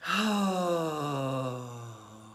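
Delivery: long voiced "ohh" exhale, falling away; noisy sigh. Shown as a demonstration. Leader's noise giving listeners permission, long voiced sigh. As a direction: sigh out slowly with open sound. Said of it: "My noise gives you permission".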